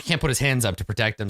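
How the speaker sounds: usual speaking voice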